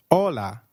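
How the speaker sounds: "Hola" with no H sound, starting on the vowel; silent H. Shown as a demonstration. Teacher models "Hola" for learners, silent H. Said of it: "H is always silent in Spanish".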